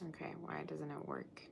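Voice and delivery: whispered to herself; whispering. Real, not acted. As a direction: mutter it under your breath. Muttering to herself over title.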